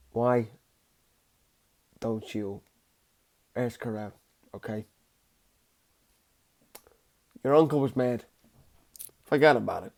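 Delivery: lightly voiced mobster, low and quiet; understated impression, words dropped low between pauses; Robert De Niro. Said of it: "not the best Robert De Niro impression".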